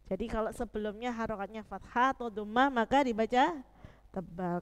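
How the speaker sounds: usual speaking voice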